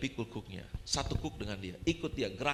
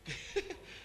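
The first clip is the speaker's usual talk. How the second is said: high, breath-filled giggle; soft laugh at your own slip; quiet, mostly voiceless chuckle; embarrassed chuckle; 13 semitones higher, 6 dB quieter than usual. Real, not acted. Laughing at his own word stumble.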